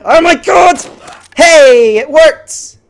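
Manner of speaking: high, loud shout of surprised joy; excited shout. Real, not acted. A gamer shouts at a lucky random kill, thrilled.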